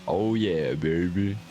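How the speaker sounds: playful American accent, hammed up; American country drawl. Streamer teasing chat in a mock American accent. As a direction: croon it like a mock country singer. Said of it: "I'm gonna be a country singer".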